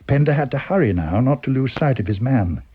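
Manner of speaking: plain reading voice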